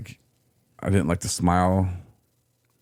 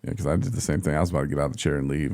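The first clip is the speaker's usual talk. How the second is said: normal talking voice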